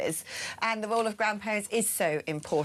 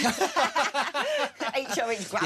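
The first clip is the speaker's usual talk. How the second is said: laughing; 5 semitones higher, much slower than usual. Real, not acted. Joking about royal nicknames.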